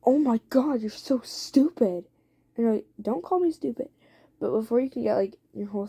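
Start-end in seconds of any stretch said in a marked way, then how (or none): 0.1-2.1 acted angry outburst, louder and higher at first; really angry. Jason insults his partner mid-fight, really angry. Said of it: "gets really angry". 3.0-3.9 higher-pitched mock indignation; indignant retort. Listener answers Jason's insult, indignant.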